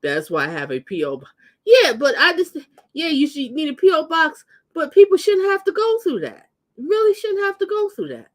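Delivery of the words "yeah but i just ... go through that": higher and louder, worked up; fired up about package thieves; heated rant. Real, not acted. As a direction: rant with rising indignation.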